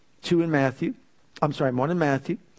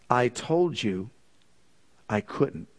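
firm correction, slow and lowered; stern; 4 semitones lower, slower than usual. Retold or imitated. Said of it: "then he got real stern with me".

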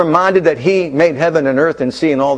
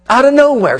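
ordinary talk

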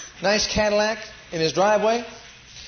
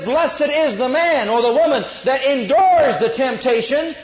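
loud, slow, high, ringing out the verse; preached correction, stressing endures; emphatic scripture quote; 6 semitones higher, 6 dB louder, slower than usual. Shown as a demonstration. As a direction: proclaim the true verse over the wrong one. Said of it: "But it says".

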